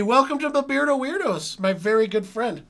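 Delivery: high, loud, beaming greeting; excited welcome. Real, not acted. Welcoming his friend Nori to the stream, high and loud with excitement.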